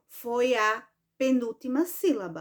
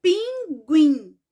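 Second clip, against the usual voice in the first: loud "pinguim" in two beats, "guim" punched; deliberate "pinguim" with a strong last syllable; stress on last syllable; 3 semitones higher, 6 dB louder than usual. Shown as a demonstration. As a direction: hit the final "guim" of "pinguim" hard. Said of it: "fechar os olhos e pronunciar a palavra".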